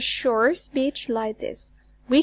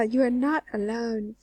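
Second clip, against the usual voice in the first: ordinary talk, nothing marked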